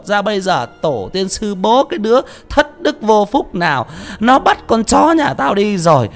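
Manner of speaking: hammed-up wailing tirade of an angry mother; shrill village curse. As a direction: rail at the unknown dog thief from the gate. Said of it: "hợp công mà chửi".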